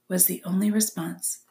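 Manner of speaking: usual speaking voice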